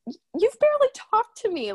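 incredulous. Retold or imitated. Retold indignant thought about placement lady.

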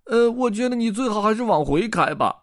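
high-pitched fish voice urging caution; high character voice, mild protest; hesitant goldfish. Acted. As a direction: suggest it up high, as a wary little fish. Goldfish urges turning back.

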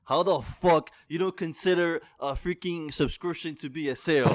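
heated wrestling promo rant, loud. Acted. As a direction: cut a fired-up heel promo. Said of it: "I cut a promo".

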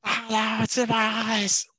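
high, hammed-up panicked cry; mock Cage yell. Podcaster repeats Cage's line, hammed-up panicked yell. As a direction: yell it again as frantic Cage. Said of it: "yells out".

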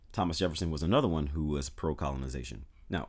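ordinary talk, nothing marked

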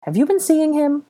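slow plea leaping into a high, loud question; insistent, accusing interrogation; demanding. A narrator's reading. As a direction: demand an answer, voice shooting up. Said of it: "Harry demanded".

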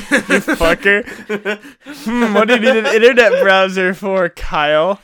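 loud, suspicious teasing hmm. Real, not acted. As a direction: tease him loudly, mock suspicion. Teasing accusation about browser use.

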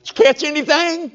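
high mock wife voice at the door; nagging wife. Wife at the door, mimicked in a high nagging voice.

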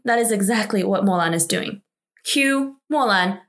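ordinary talk

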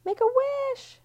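high, loud party call; a guest calling out to the birthday boy. Acted. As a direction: shout it across the room, high and excited. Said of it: "someone called".

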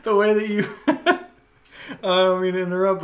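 laughing while interrupting, high and loud; breaking up mid-sentence. Real, not acted.

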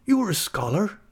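high-pitched urgent rustic voice; agitated, pitched high; hurried, distressed countryman. Acted. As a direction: appeal anxiously for help. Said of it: "he said hurriedly".

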